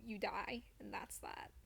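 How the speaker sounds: ordinary talk, nothing marked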